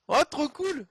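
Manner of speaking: high, loud burst of delight; thrilled. Real, not acted. Presenter delighted by a surprise on air, thrilled. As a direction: exclaim with sudden excitement.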